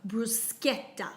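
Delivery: bruschetta with a hard k; bruschetta said slowly with a k sound; correcting pronunciation of bruschetta. Shown as a demonstration. Co-host corrects Wesley on bruschetta, hard k in bruschetta. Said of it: "it's pronounced bruschetta".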